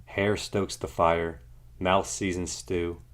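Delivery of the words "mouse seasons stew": ordinary delivery, nothing marked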